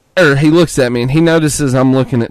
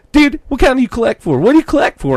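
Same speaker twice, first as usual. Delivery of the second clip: much higher, pushy bro voice; imitating the bro salesman; 12 semitones higher, 6 dB louder, faster than usual. Quoting the pushy clerk, high bro voice. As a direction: show off your setup like a pushy bro. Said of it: "I get on".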